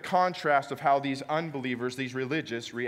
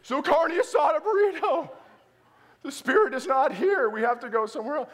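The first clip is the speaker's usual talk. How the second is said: much higher, louder mock anguish; comic despair; 11 semitones higher, 5 dB louder than usual. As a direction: wail it like the spirit has left. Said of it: "I'm like".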